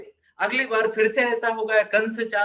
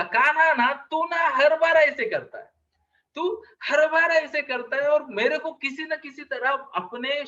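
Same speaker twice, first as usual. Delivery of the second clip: exasperated complaint; 4 semitones higher than usual. Retold or imitated. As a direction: whine at a brother who always wins. Elder brother grumbles at Kanha.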